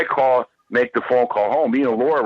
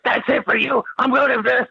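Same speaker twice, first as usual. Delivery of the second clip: put-on angry girl; 11 semitones higher than usual. Angry girl quoted in bar.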